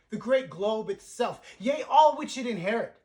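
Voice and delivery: full-voiced theatrical peak; booming climax. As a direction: build to a ringing shout on the globe. Climax of Prospero's speech.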